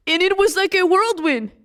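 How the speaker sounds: ordinary delivery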